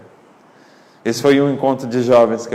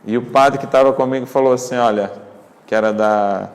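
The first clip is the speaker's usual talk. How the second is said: normal talking voice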